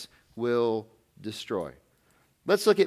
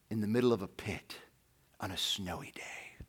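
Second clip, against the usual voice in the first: dramatic whisper; 6 semitones lower, 11 dB quieter than usual. Acted. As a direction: whisper the ending, hushed. Dramatic storytelling hush.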